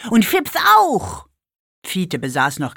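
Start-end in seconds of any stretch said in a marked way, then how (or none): 0.1-1.3 put-on very high, loud boy voice; excited shout. A narrator's reading. Fiete runs around the table shouting impatiently, high and loud. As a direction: shout it out like an impatient six-year-old. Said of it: "rief er".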